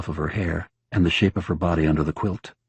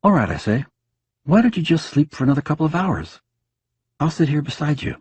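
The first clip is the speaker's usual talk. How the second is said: bright husband chatter; 9 semitones higher than usual. Acted. Husband soothing dead wife.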